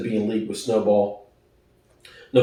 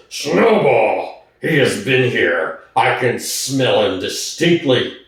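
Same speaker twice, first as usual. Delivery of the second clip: lightly voiced pig leader, a hint of menace; a touch of the dictator accusing his rival; terrible voice; 2 semitones lower, slower than usual. Napoleon sniffing for Snowball, lightly voiced terrible exclamation. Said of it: "exclaim in a terrible voice".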